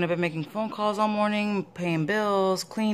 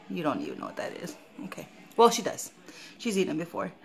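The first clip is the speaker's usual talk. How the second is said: ordinary delivery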